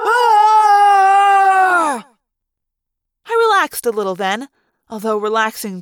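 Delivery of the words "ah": long high held scream; long loud villain scream. Acted. Visser screaming as his fish is blasted away, long and loud. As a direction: wail it out as he flies. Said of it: "Thoughtspeak voice crying".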